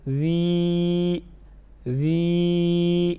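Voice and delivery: long ذی with a soft consonant. Shown as a demonstration. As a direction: hold each ذی on one note, softly articulated. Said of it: "ذال کو نرم پڑھیں گے". (نرم اور لمبی ذی)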